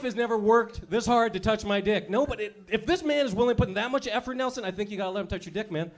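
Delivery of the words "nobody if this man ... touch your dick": normal talking voice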